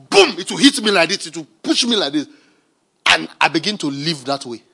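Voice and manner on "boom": boom sound effect. Acted. Revelation lands.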